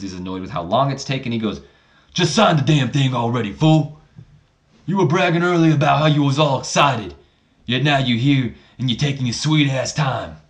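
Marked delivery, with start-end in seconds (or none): 2.1-10.4 louder, higher impatient Booker T; Booker T impression. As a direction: growl at The Rock to hurry up. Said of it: "Booker T's act, voice kills me".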